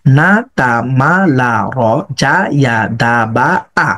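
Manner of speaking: "না তা মা লা র" and "জা ইয়া দা বা আ" strung together evenly; combined joined-up drill. Shown as a demonstration. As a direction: link both letter groups in a steady rhythmic run. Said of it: "আগের পড়াটার সাথে মিলিয়ে দেন". (দুই অংশ একসাথে মিলিয়ে পড়া)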